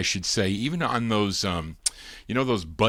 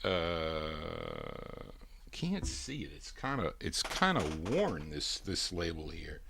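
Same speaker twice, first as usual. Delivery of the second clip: away from the mic; 6 semitones lower, 15 dB quieter than usual. Real, not acted. Checking the record label off-mic.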